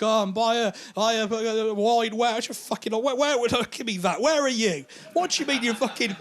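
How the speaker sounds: put-on drunk friend guiding them by phone; drunk mate on phone.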